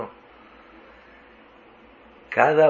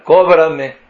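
pidiendo cortés; 4 semitones higher, 7 dB louder than usual. Retold or imitated. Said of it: "y yo le escribo".

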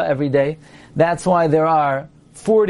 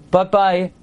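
ordinary delivery

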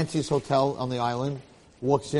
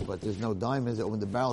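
plain reading voice

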